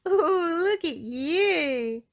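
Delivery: high, loud, slow laughing taunt; mocking laugh. Miss Trouble mocking bruised Mister Small through laughter. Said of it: "Miss Trouble laughed".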